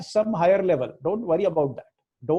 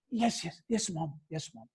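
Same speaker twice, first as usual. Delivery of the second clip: put-on obedient child; 2 semitones lower, 6 dB quieter than usual. Swami replying to mother, mock obedient child. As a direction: answer meekly, dying away on each repeat. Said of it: "Like an obedient child".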